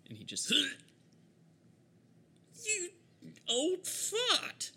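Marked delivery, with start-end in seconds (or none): 0.5-4.7 high, strained, whispery gasp; high, choked villain voice; struggling villain. Acted. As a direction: force the insult out in bursts. Game master voices the held villain.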